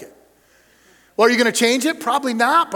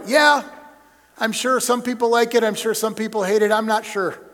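ordinary talk, nothing marked

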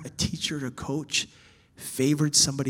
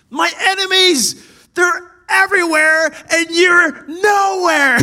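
much higher, loud, slow anguished shout; David crying out; 13 semitones higher, 12 dB louder, slower than usual. Acted. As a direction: shout it to the sky in despair. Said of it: "You look at David".

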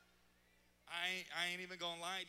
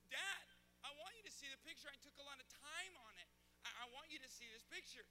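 whiny little son; 5 semitones higher, 6 dB quieter, faster than usual. Retold or imitated. Son insisting dad looks.

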